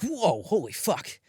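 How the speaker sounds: slow, drawn-out amazed gasp; awed crowd reaction. Retold or imitated. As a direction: act out a stunned crowd.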